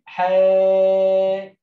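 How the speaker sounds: loud, long-held aa in حاكم; long vowel demo. Shown as a demonstration. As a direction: stretch the first syllable of حاكم, then finish كِمٍ. Teacher demonstrates long vowel syllable. (مد الألف في حاكم بصوت عالٍ)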